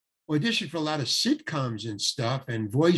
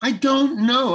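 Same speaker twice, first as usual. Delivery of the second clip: high and louder "I don't know", clipped; impatient, just want out; fed-up attitude; 9 semitones higher, 7 dB louder, slower than usual. Retold or imitated. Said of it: "that's the way I said".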